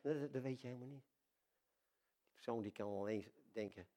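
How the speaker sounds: ordinary talk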